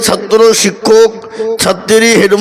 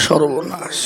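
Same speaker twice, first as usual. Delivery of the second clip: dismayed mutter; 6 semitones lower, 10 dB quieter, slower than usual. Acted. Headmaster stunned, can't answer.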